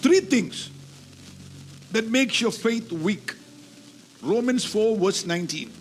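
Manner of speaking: usual speaking voice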